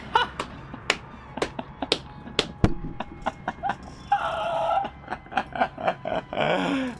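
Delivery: high, breathy disbelieving laugh; relieved laughter. Real, not acted. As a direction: laugh out the shock of winning. Laugh after surprise win.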